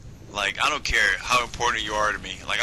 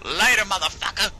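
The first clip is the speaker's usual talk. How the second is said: high, taunting send-off; hammed-up taunting shout; mock gleeful farewell; 9 semitones higher, 3 dB louder than usual. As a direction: toss it back as you abandon them. Acted callous goodbye to fallen friend.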